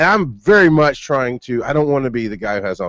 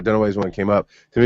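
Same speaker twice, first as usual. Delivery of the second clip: normal talking voice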